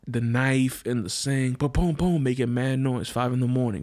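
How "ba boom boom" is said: higher, punchy boom boom; quick sound effect of things dropping; mock banging noises. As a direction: make the clatter with your mouth. Imitating dumping noise.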